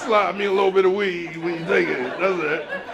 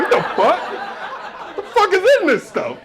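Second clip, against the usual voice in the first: higher, startled outburst; mock alarm; 10 semitones higher than usual. Drinker shocked by Mountain Dew, higher mock alarm. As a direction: react like the soda just mutated. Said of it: "You like".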